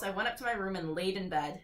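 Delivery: ordinary delivery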